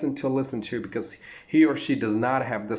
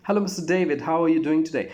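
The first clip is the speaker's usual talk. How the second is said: even brighter, lifted, louder greeting; melodic greeting; 5 semitones higher, 4 dB louder, faster than usual. Shown as a demonstration. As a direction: push the melody further up. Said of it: "Another one".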